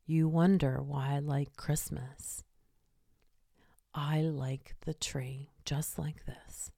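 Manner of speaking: low, slow dramatic whisper; whispery dramatic voice. Retold or imitated. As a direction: breathe it out like a movie dog's inner voice. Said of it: "Shadow's voice was very whispery and very dramatic".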